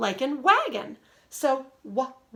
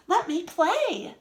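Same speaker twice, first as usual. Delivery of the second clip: "Let me play" said a bit more excited, with a higher lift; 5 semitones higher, slower than usual. Shown as a demonstration. Teacher showing students how an exclamation point sounds, mildly excited. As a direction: read it like it ends with an exclamation point. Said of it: "exclamation point if you wanna sound more excited".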